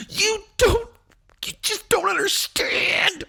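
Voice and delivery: very high, hammed-up crying voice; mock tearful wailing. Wife accusing Johnny of causing pain, mock sobbing. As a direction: exaggerate a sobbing, hysterical plea. Imitating wife's tearful outburst.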